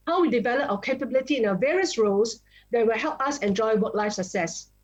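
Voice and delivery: usual speaking voice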